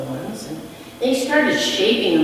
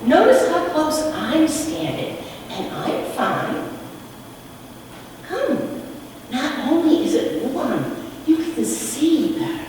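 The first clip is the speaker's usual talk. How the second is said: gentle stranger; 8 semitones higher than usual. A narrator's reading. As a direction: coax them kindly toward the fire. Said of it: "And he said".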